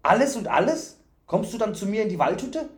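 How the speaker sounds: high, excited, rising questions; delighted fool. A narrator's reading. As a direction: ask with overjoyed eagerness. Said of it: "rief der Narr erfreut".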